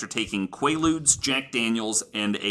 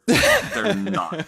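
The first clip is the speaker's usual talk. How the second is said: high laughing burst on the last words; laughing punchline; 10 semitones higher, 3 dB louder than usual. Real, not acted. Host to co-hosts, delivering the punchline laughing. Punchline about the band never sober.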